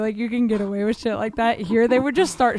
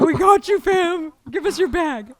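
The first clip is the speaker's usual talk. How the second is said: higher-pitched, jokey buddy voice; playful homie dogs; 7 semitones higher than usual. Pretend. Guard dogs helping the friend over, playful homie voice. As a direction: play the dogs as her eager pals.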